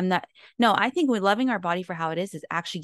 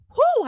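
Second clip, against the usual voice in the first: whoo; 7 dB louder than usual. Retold or imitated. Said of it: "I was like".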